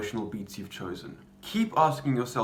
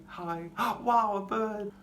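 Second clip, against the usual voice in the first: higher register; 10 semitones higher, slower than usual. Shown as a demonstration. Character greets and spots a bird, high register. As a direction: lift it way up into an airy high register. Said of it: "maybe a higher register".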